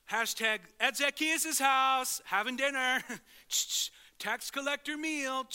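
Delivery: loud, high, slow hammed-up bragging; loud, high, mock-excited show-off; mock social media gush. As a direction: gush like you're posting it online. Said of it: "grabbing your phone and be like".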